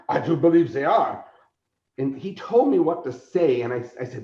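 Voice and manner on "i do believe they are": lightly put-on German sidekick accent; bad German accent. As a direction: answer in a hammy German accent. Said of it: "He has a bad German accent".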